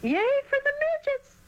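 put-on very high squeaky girls' cheer. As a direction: yell it high like a row of girls. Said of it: "they yelled".

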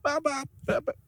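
high, faltering hen clucks; feeble chicken impression. Host struggling to do a chicken impression, high clucks. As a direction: attempt a high cluck without much falsetto. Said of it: "I can't even chicken impre".

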